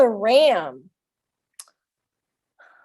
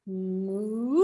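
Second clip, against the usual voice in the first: put-on soft lowing moo for the kids; mock cow moo; 4 semitones lower, 6 dB quieter than usual. Shown as a demonstration. Teacher mooing softly for the kids. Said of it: "what sound does an ox make".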